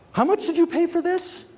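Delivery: high, disbelieving cry; mock outrage at the price; incredulous. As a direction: ask it in shocked disbelief. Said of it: "And I'm like".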